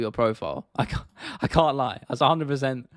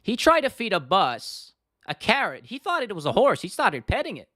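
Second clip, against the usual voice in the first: Chael Sonnen; 8 semitones higher than usual. Acted. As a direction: stretch it out in a high-pitched fighter impression. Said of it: "no one speaks like Chael".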